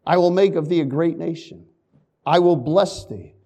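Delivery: ordinary talk, nothing marked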